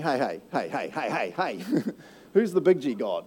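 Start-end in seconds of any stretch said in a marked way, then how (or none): 0.0-1.8 lower, sing-song taunting heys; teasing God. Acted. God teasing the Egyptians, low playful heys. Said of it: "and just saying".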